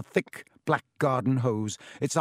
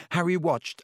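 ordinary delivery, nothing marked

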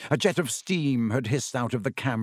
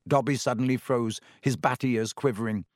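plain reading voice

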